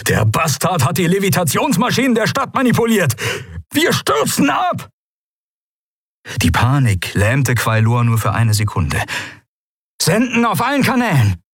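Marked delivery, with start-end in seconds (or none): 0.1-4.9 frantic high yell, climbing to a scream; bellowing. Acted. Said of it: "brüllte die Stimme des Admirals". 10.0-11.4 high, shouted order; urgent command. Acted. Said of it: "befahl er".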